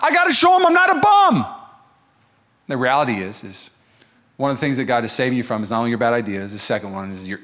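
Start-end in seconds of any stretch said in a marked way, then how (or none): 0.0-1.5 high, loud Rocky yell; Rocky shout. Retold or imitated. Rocky insisting he is not a bum, loud and high. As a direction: belt it out like Rocky. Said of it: "He's like".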